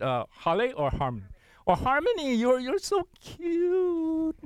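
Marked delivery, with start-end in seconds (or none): 1.8-4.4 put-on very high, very slow baby talk; cooing pet talk. Cooing to the dog Harmony, very high and slow. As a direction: stretch it out sweetly to a pet. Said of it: "just the tone of our voice".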